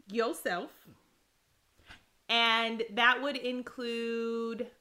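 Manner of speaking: slow, emphatic, spaced-out declaration; formal speech. Acted. Wife listing demands to her spouse, formal. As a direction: lay down each demand deliberately, with weighty pauses.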